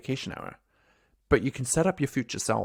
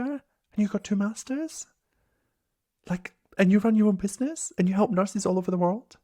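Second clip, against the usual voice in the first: incredulous questions; 5 semitones higher than usual. Retold or imitated. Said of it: "new graduate Liam would be like".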